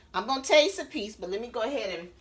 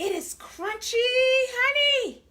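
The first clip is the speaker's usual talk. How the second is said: excited; 14 semitones higher, 4 dB louder, much slower than usual. Real, not acted. Bragging about crispy chicken.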